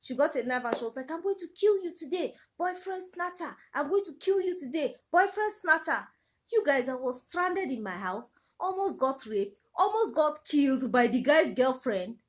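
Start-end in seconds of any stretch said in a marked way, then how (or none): 1.1-6.1 high, loud, furious yelling at a rival; furious threat. Retold or imitated. Jealous girlfriend screams at the supposed boyfriend snatcher, furious. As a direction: scream the threat at her like you mean murder.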